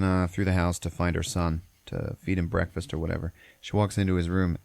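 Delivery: ordinary delivery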